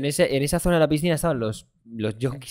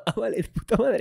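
mock relief; 8 semitones higher than usual. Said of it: "Y yo".

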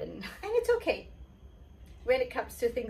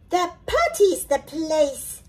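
higher, proud little princess; 7 semitones higher, 7 dB louder than usual. Acted. The princess showing off her potty skills, proud. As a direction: announce it with pride. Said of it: "said the little princess proudly".

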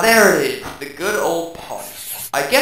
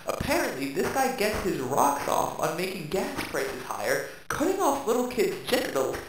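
ordinary talk, nothing marked

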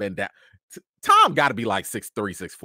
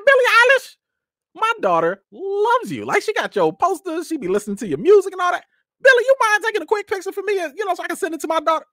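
much higher, rushed and breathless with excitement; gushing fan; 5 dB louder than usual. Retold or imitated. Dad asking [name] for a picture, gushing. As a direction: gush and rush the ask for a photo.

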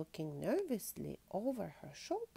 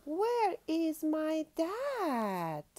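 high, loud, drawn-out wail of a question; lost cub calling; 10 semitones higher, 5 dB louder, much slower than usual. A narrator's reading. Patty looking for her lost dad, calling high and slow. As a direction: stretch it out, calling high for a missing parent.

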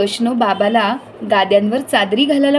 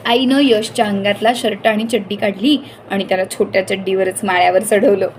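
ordinary delivery, nothing marked

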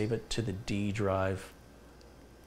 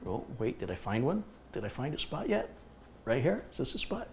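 softer, rising to higher questions; soft playful pet talk; 5 semitones higher, 7 dB quieter than usual. Real, not acted. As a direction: coax the dog, climbing higher. Searching the spot, soft.

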